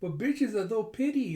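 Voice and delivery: put-on high, garbled kid through a snorkel; excited, muffled consonants, like mouth blocked; excited snorkel-mask talk. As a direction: gush with the mouthpiece in. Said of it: "getting so excited with her snorkeling mask going".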